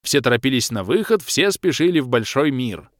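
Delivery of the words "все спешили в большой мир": usual speaking voice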